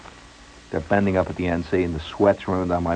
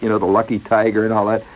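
ordinary delivery

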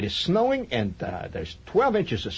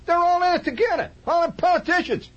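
much higher, loud griping blowhard; Slav voice; 4 dB louder than usual. Retold or imitated. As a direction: complain like a know-it-all. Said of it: "Either that or you say".

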